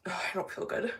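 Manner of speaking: softly, feeling sick after a burp; queasy after burping. Real, not acted. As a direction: groan it out, stomach upset. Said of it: "Sorry, I just burped".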